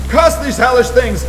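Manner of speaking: high, loud shriek of horror; horrified shriek. A narrator's reading. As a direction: scream it out in terror. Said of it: "rose into a shriek fraught with all the horror".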